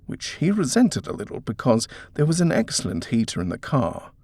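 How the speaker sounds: ordinary delivery, nothing marked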